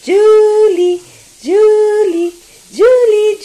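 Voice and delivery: loud call for the dog. Real, not acted. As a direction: call Julie across the room. Calling the dog loudly.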